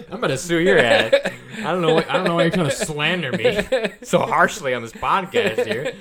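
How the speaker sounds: high, loud, laughing mock indignation; laughing mock outrage. Real, not acted. Friend laughs while pretending to threaten his slandering co-host.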